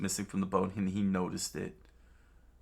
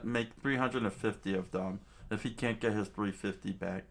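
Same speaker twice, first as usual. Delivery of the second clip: ordinary delivery, nothing marked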